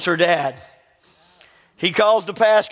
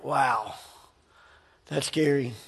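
a much softer, lower "wow, that's scary"; hushed awe; 5 semitones lower, 8 dB quieter, slower than usual. Retold or imitated. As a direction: hush it as if chilled. Awed by friend's words.

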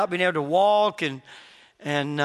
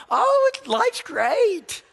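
high, forced chipper brush-off; fake cheerfulness; 13 semitones higher, 3 dB louder, much slower than usual. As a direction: pretend everything's great, bright and fake. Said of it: "fake it till he makes it".

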